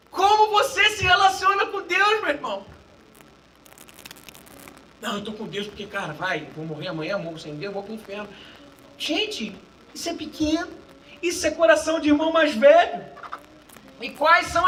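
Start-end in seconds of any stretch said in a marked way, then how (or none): 5.0-8.3 low, soft mumbling of a lukewarm believer; mock pragmatic believer. Preacher mocking a believer who only fears hell, low and soft.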